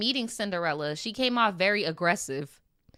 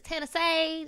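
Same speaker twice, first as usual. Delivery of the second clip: put-on Tennessee accent; 8 semitones higher than usual. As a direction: playfully imitate a camp Tennessee accent.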